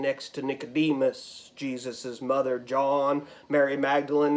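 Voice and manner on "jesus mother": usual speaking voice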